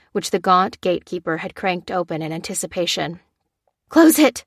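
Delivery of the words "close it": high loud urgent shout. Acted. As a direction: yell the order at full force. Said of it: "she cried".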